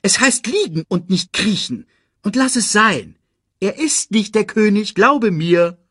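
high-pitched irritated scolding; snapping. A narrator's reading. Timon corrects grovelling Pumba, high and irritated. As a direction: tell off your friend crossly. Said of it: "fuhr Timon ihn an".

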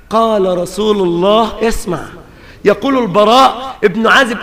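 normal talking voice

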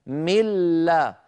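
من لا with doubled l, no n; nun merged into lam. Shown as a demonstration. Teacher isolating the merged lam, من لا as mil-la. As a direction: break off the word as mil-la for من لا. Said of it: "قد حولت النون إلى لام ساكنة".

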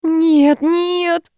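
high-pitched drawn wail; wailing lament. A narrator's reading. Owner watching cat chew her treasured toy, wailing. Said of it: "причитала я".

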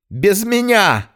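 high, slow desperate yell; wailing yell. Acted. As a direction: howl it out in defiance. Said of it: "завопил Иннокентий". (отчаянный вопль)

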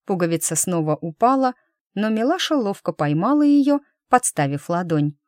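ordinary delivery, nothing marked

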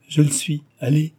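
insist, in some consternation; dismayed. A narrator's reading. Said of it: "affirma Guilledou d'un air consterné". (d'un air consterné)

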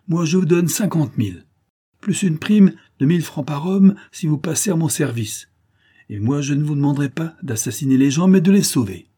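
high, forceful pitch to a crowd; rousing offer. A narrator's reading. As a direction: win them over with loud conviction. Judex outbids to win the crew.

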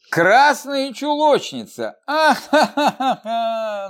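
high, slow, showy laughter breaking over красные; hammed-up mocking cackle after красные чулочницы; deliberate loud laugh. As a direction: laugh theatrically, throwing her head back. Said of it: "нарочито захохотала Ада".

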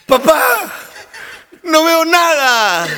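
high, very slow, drawn-out wail; blind daughter. Retold or imitated. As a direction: stretch it out like a stunned blind girl. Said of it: "y dice".

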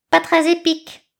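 high, superior eagle voice; correcting eagle. A narrator's reading. Eagle correcting the name, high and superior. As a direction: correct them from above. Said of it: "corrigea Aiglon".

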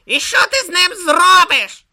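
loud, high-pitched demand; indignant challenge. A narrator's reading. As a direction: demand it shrilly and loudly. Om challenges Brutha in quarrel.

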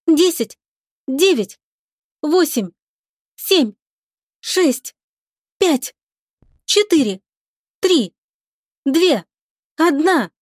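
launch countdown. A narrator's reading. Lika counts the crew down to launch, high measured countdown.

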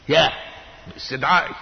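normal talking voice

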